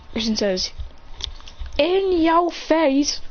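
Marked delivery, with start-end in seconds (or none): 1.8-3.2 taunt. Acted. Character taunts the losers.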